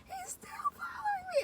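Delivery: high, tearful wail; crying panic. Retold or imitated. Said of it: "I'm like".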